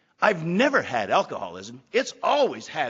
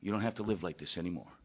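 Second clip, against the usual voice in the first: low, soft and calm reassurance; a quiet answer to his despair; gentle inner voice; 7 semitones lower, 8 dB quieter than usual. Retold or imitated. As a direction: speak it softly, like a voice from within. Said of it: "I heard a voice say".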